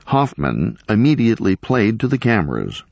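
usual speaking voice

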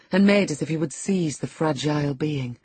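plain reading voice

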